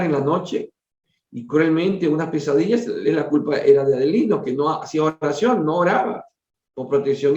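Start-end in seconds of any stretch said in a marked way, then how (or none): none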